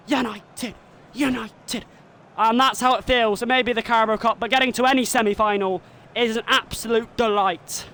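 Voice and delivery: excited play-by-play, rising with each penalty; live commentary. Acted. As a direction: call the shootout like a TV commentator.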